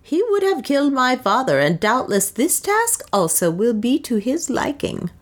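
high-pitched, scornful taunt; mocking. A narrator's reading. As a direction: keep needling with scorn. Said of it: "mocked Soa".

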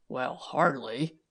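slow, dry understatement. A narrator's reading. Tom to Milly, a slow dry understatement. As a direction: let it drop slowly, bone dry. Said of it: "said Tom dryly".

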